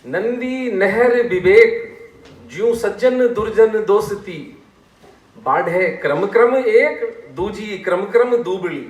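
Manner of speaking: high, loud, slow verse recital; measured, lilting poetic delivery; sortha recitation. Shown as a demonstration. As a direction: declaim each line in full voice, vowels stretched. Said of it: "अब ये सोरठा फिर सुने आप".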